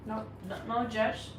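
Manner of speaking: ordinary delivery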